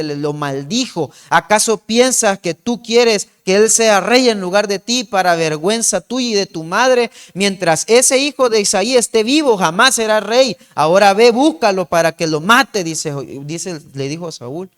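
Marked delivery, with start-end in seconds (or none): 1.3-12.8 loud, commanding fury; raging king. Retold or imitated. Saúl ordering Jonathan to fetch David to be killed, raging. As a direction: bark the orders like a mad king.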